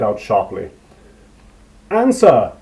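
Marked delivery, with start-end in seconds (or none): 1.9-2.5 loud, high furious snap; furious snarl. A narrator's reading. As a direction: spit the order out in rage. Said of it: "came a furious snarl in her ear".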